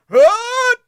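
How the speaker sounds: very high, loud mocking Huh; imitating New York crowd noise; mock crowd grunt. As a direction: ape the crowd's yell. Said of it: "the other side of the arena goes".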